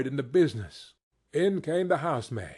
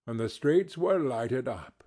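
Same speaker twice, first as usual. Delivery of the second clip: plain reading voice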